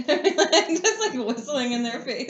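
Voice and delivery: giggling through words; laughing. Real, not acted. Laughing while talking.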